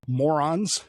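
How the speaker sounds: ordinary delivery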